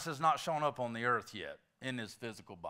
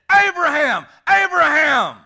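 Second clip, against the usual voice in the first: loud, high double call of "Abraham"; calling from heaven; 11 semitones higher, 17 dB louder than usual. Acted. Preacher voicing Jesus calling from heaven, a big shout. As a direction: call down from heaven to stop him. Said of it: "Jesus Christ shouting from heaven".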